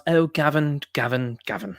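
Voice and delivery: mock British accent. Commentator mimicking a woman addressing Gavin, mock British accent. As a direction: imitate her with a hint of British accent. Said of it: "affected her British accent".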